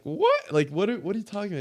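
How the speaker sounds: high squeaky disbelieving what, then a fast rush; shocked he means it; reacting to the cousin's surprise burger shop offer; stunned disbelief. Stunned by sudden business offer.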